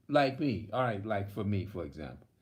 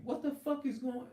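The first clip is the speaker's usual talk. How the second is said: exasperated; 13 semitones higher, 4 dB quieter than usual. Retold or imitated. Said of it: "it's like I'm saying to myself".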